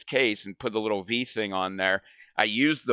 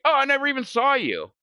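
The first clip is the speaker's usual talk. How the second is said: clueless dad; 14 semitones higher, faster than usual. Retold or imitated. Dad's clueless reply.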